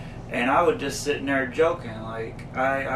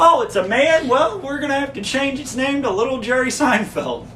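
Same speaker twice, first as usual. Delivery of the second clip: much higher, louder goofy Kramer voice; mimicking Kramer; 10 semitones higher, 6 dB louder than usual. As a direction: ham up the clueless Kramer. Kramer voiced in retold scene.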